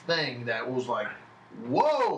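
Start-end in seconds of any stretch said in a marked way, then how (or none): 1.7-2.2 mock amazement. Amazed at the difference.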